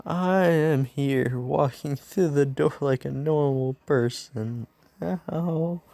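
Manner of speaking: slow, drawn-out heroic announcement; subdued hero entrance. Acted. Injured hero retries his entrance, slow and dutiful proclamation. All Might re-enters with bump on head.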